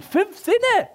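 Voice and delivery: very high mock outraged squeal. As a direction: squeak it in disbelief. Mocking God's stingy creation. (hohes, spöttisches Quieken)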